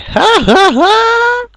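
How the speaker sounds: squeaky, slow mock laughter; high fake laugh. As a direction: ham up a high-pitched laugh.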